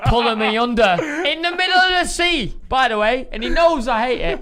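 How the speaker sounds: high, loud, incredulous outburst; exasperated retelling. Retold or imitated. Friend retelling being pulled under at sea, exasperated. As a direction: exclaim it high and loud, half laughing.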